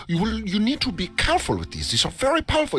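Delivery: Dutch shopkeeper impression. Shopkeeper warning the tourist about strong truffles, high put-on voice. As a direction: warn like a fussy Dutch clerk. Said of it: "doing an impression of him".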